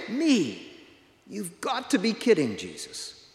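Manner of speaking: can't believe he was chosen; amazed Paul. Acted. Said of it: "Paul is amazed by this".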